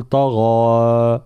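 طغى recited slowly with a full, heavy final alif; drawn-out طغى with emphatic ending; heavy long alif. Shown as a demonstration. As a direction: hold the last vowel of طغى dark and emphatic. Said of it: "الألف المادية مفخمة".

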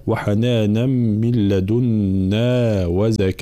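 slow chanted tajweed recitation; slow Quran chant; Quranic recitation. Shown as a demonstration. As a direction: recite it measured and melodic.

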